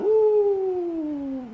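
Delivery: sudden high falsetto woo; a quick high whoop of amazement. Real, not acted. Exclaiming at how long he stayed.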